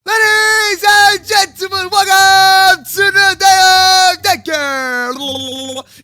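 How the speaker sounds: slow, loud hyped announcer; grand arena introduction. Pretend. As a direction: boom it like a ringside MC. Announcing the event.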